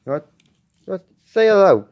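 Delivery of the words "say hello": high, loud prompt to a pet; calling out. Real, not acted. Prompting the cat to greet.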